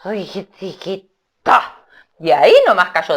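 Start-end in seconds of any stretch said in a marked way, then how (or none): 0.0-1.8 weak, slow fading creature voice; a hint of a dazed animal call before fainting; soft slow mongoose cry. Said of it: "de la cobra y dijo".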